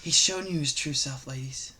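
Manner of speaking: very soft, low near-whisper; hushed, low advice to the ladies; mock microphone confidential. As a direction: murmur intimately into a pretend microphone. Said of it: "Let's imagine this was a microphone".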